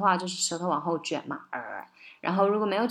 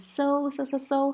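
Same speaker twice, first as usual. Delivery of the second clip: higher, repeated emphatic "so"; so, pitched up each time; stressed SO; 7 semitones higher, slower than usual. Shown as a demonstration. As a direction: hit each so as the accent. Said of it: "是重音哈".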